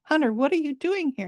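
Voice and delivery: high friendly monkey voice inviting the lost hunter in; old monkey voice. Acted. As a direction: welcome him in like a kindly old monkey. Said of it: "the old monkey says".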